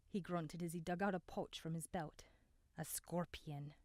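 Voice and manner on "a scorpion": a softer, grim whisper. A narrator's reading. Abram names the scorpion to Ayanna in a whisper. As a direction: breathe the word out low.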